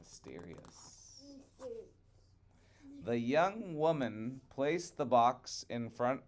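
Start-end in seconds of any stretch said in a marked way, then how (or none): 0.0-1.2 very soft whispered aside; hushed aside. Real, not acted. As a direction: breathe it out mysteriously. Aside while reading.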